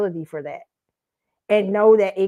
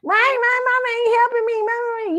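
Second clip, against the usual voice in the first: put-on much higher whiny girl voice; mocking whiny girls; 13 semitones higher than usual. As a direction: mimic a girl whining about her mama. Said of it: "These girls don't think, they".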